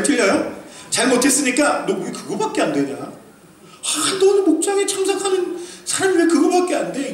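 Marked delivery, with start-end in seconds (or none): 1.9-7.1 a mock-angry rebuke to a church member; mock scolding. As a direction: scold them, half joking, for falling short. Acted scolding of a failing member.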